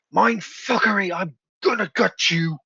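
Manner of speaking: loud, high acted yell; angry guard's threat. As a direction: shout a threat at a fleeing enemy. Game master voices enraged guard.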